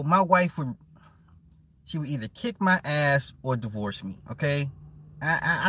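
normal talking voice

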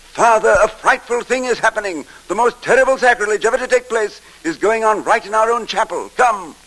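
high, loud and urgent; a frightened monk raising the alarm; panicked alarm. Acted. Breathless monk seizes the abbot, crying out in panic.

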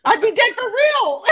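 high, loud excited laugh into the line; delighted laugh. Real, not acted. Fan thrilled at the idea of Tim Curry, high delighted laughter. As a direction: gush with giddy delight.

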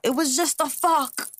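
high, drawn-out mock yell; imitated yell. Woman retelling a cheating husband's outburst, mock yell. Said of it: "he yelled out".

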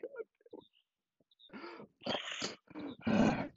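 high, softish exasperated voice; high exasperated groan; frustrated groan. Acted. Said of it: "And he's like".

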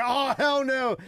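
high-pitched indignant refusal; retold high, sassy outburst; mock outrage. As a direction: imitate someone outraged and ready to fight. Retold woman's outraged reaction.

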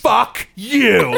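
loud, high, drawn-out mock insult; imitated yelling. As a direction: yell it like an abusive fan. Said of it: "yell at me".

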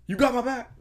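high, loud imitation of a coach; mock coach rallying. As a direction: belt it out as a parody pep talk. Mimicking the coach from the video.

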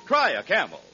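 ordinary delivery

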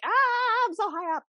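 mock panic. Retelling her fear of heights.

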